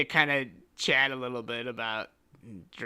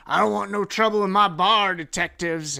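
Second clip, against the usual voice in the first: raised, loud bartender's protest; wary bartender; 8 semitones higher, 10 dB louder than usual. Acted. Bartender warning detectives off trouble, loud protest. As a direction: warn them off loudly, pitched up.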